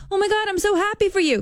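high, bursting with real joy; genuinely thrilled. Shown as a demonstration. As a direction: gush it out, truly delighted, way up high. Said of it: "'cause I'm truly happy".

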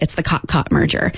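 usual speaking voice

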